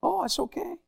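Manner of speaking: high, light put-on voice; a chirpy fake-casual answer; mock casual reply. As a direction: toss it off in a high voice. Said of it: "retold reply".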